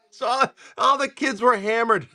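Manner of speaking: high-pitched, laughing through the words; really cracking up while talking. Real, not acted. Storyteller recalls the kids getting hammered, laughing.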